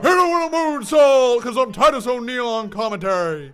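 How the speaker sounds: loud, high booming commentator voice; Titus O'Neil impression. Streamer doing Titus O'Neil on commentary. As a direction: shout it like a hype man. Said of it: "terrible impression".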